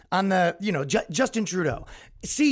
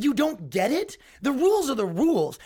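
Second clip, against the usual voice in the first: ordinary talk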